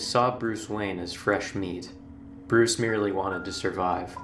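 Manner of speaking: ordinary delivery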